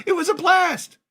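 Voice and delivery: very high-pitched gleeful squeal; excited squeal. Real, not acted. Recalling a childhood commercial shoot, high excited squeal.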